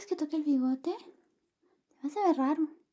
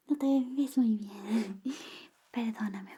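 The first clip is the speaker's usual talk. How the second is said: whisper; 6 semitones lower, slower than usual. Acted. ASMR whisper roleplay.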